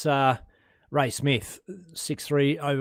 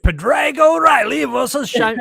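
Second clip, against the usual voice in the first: hammed-up high Irish accent; mock Irish brogue, loud; 12 semitones higher, 9 dB louder, slower than usual. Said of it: "I can't do an Irish accent".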